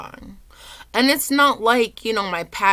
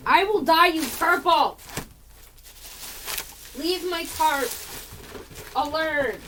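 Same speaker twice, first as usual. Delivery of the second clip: higher, sharp scolding; scolding the dog; 8 semitones higher, 4 dB louder than usual. Real, not acted. Scolding her dog for chewing the tarp. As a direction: tell the dog off for real.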